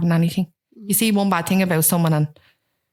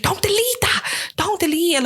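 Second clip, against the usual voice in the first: high-pitched imitation of an insistent friend; urgent retold plea; 11 semitones higher than usual. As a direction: jump up high, imitating someone urging her to stop. Retold friend urging against deleting.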